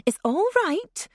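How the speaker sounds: put-on mother trying to sound jolly to calm the child; forced jocularity. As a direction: brush it off cheerily, barely hiding alarm. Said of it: "a jocular note that failed to disguise".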